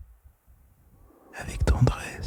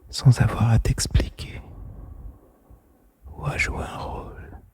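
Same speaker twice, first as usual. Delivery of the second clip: ordinary delivery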